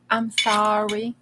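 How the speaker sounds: usual speaking voice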